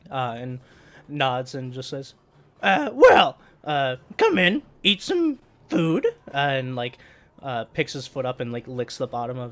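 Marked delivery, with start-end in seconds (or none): 2.6-6.0 high, loud, slow host voice; NPC voice. Acted. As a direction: welcome them in a raised voice.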